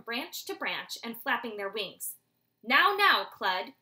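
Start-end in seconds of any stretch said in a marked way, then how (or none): 2.6-3.7 high, much louder fatherly scolding; father's loud, high admonishment; admonishing. Acted. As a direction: warn him, a father correcting his son. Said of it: "his father admonished".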